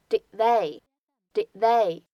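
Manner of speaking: "did they" with the d of did unreleased; "did they" with the final d held, not exploded; incomplete plosion. Shown as a demonstration. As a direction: swallow the d of "did" straight into "they". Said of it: "不完全爆破".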